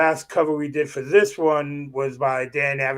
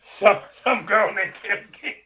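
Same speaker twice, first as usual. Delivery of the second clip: mocking repeat; 6 semitones higher than usual. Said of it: "repeats to mock him".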